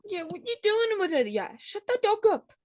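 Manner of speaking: high-pitched mock British complaint; British accent. Host plays musician annoyed by a barking dog, British accent. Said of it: "That was my British accent".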